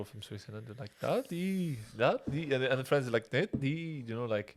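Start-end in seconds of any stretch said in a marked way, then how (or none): 1.0-2.5 high singsong Swiss lilt; Swiss up-and-down cadence. Shown as a demonstration. Imitating people from Switzerland, singsong Swiss accent. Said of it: "there's always that up and down". 3.3-4.0 higher, falling Swiss singsong; Swiss up-and-down cadence. Shown as a demonstration. Imitating Swiss friends, singsong Swiss accent. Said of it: "there's always that up and down".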